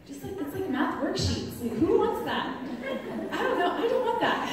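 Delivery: high, loud, laughing protest; comic exasperation. Acted. Mom joking to the audience about math worksheets, comic exasperation. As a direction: throw up your hands at the clutter. Mock exasperation at kids' school papers.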